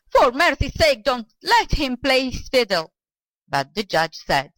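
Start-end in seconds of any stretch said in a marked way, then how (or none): 0.1-2.9 loud, high-pitched frantic plea; panicked old man crying for mercy; shouted. A narrator's reading. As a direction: beg loudly in alarm. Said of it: "shouted the old man".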